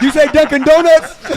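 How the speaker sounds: loud high laughing question; cracking up while asking. Real, not acted. Joking about dunking, laughing loud.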